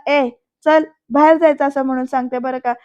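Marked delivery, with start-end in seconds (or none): 0.1-1.6 loud, curt dismissal; louder, bossy send-off; angry order. A narrator's reading. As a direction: order the intruder out sharply. Dhanashri orders the intruder out.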